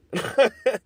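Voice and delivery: short laugh. Real, not acted. Laughs at dollar offer.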